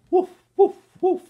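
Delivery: high loud barking woofs; dog bark. Acted. Reading to kids, dog greeting the boy with barks. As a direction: bark like an excited puppy.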